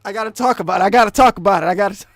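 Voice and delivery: insistent shouting. Real, not acted. Speaker insisting they have to talk about it, insistent shouting. Bursts out, repeating himself loudly.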